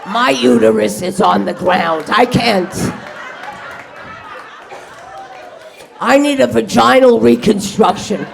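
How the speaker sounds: a hint of a frazzled chicken complaining; exhausted chicken. Overworked cruise ship chicken complaining, mock exhausted. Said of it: "a chicken going".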